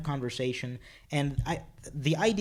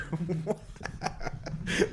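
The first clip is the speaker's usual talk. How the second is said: breathy laughter; 4 semitones higher, 5 dB quieter than usual. Real, not acted. Laughing after punchline.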